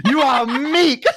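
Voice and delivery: high, loud, laughing mock insult; old-timey insult. Laughing friend mocks the old-fashioned insult meek. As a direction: hurl it like an archaic curse. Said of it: "seventeenth century insult".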